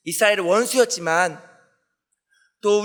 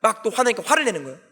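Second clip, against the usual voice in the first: ordinary delivery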